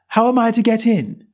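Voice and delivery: put-on a well-mannered girl asking; young Alice. A narrator's reading. Alice asks the footman.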